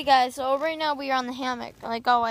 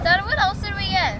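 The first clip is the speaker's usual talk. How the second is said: raised voice to reach dad; calling louder; 6 semitones higher, 5 dB louder than usual. Real, not acted. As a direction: call out to dad across the room. Repeats the question louder to dad.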